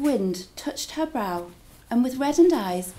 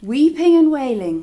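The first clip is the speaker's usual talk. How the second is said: weeping and wailing; 4 semitones higher, 10 dB louder than usual. Shown as a demonstration. Storyteller acts wailing.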